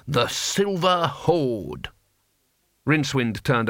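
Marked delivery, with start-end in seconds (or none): 0.1-1.9 very slow, high, drawn-out name; proud. A narrator's reading. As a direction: announce the grand title, stretching each word. Cohen proudly repeats the horde's name.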